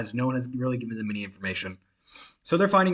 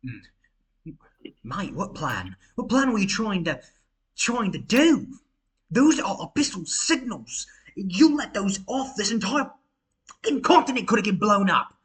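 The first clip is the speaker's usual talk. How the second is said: rising outrage, higher and louder; angry Jean; 7 semitones higher, 3 dB louder, slower than usual. Acted. Jean confronting Adrian about the signals, angrily. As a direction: builds from a murmur to a furious accusation. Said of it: "you hear Jean go".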